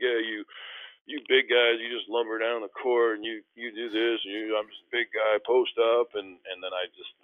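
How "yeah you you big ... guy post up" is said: mocking big guys. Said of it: "making fun of big guys".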